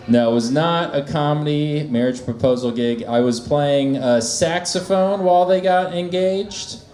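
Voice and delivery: ordinary talk, nothing marked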